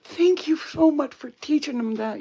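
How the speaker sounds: high, gushing fake thank-you; put-on grateful voice; mock gratitude. Mock thanks from someone being taught.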